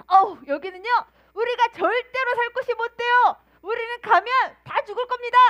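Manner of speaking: high, loud, frightened spies; fearful report. Retold or imitated. Ten spies reporting back to the people, high and fearful. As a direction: play the scared spies wailing. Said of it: "부정적인 보고를 하기 시작합니다".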